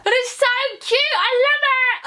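very high, gushing squeal; excited squeal. Real, not acted. Vlogger gushing over her new bra, excited squeal. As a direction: squeal with delight, pitched right up and loud.

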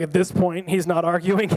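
ordinary talk, nothing marked

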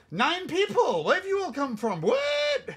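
high-pitched surprise, climbing to a squeak; real disbelief, jumping up high on WHAT; amazed, pitch shooting up; astonished. Real, not acted. Startled by sudden viewers arriving.

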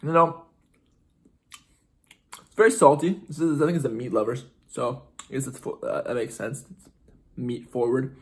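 speaking around hot food; chewing while talking. Real, not acted. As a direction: mouth partly full. Seen 4: Bit pizza at -7; jaw and lips keep working throughout the labelled words.

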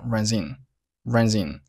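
"runs in" joined as "run-zin"; linked consonant to vowel. Shown as a demonstration. Teacher models "runs in" for learners as one linked word. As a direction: slide the z of "runs" into "in". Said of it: "runs和in辅元连读".

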